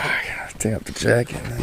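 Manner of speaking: whispered aside to himself; whispering. Retold or imitated. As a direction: whisper it under your breath. Retold muttering to himself.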